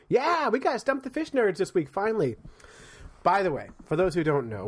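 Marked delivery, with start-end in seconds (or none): 0.1-2.4 high-pitched, eager announcement; happy high-voiced whoop into the line; excited cheer. Real, not acted. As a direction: celebrate the segment's return, pitched way up. Cheers the returning segment.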